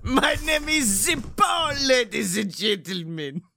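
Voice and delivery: put-on Italian accent, shouted like a showman; mock Italian accent. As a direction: boom it out in a hammed-up Italian accent. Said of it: "what accent I was doing".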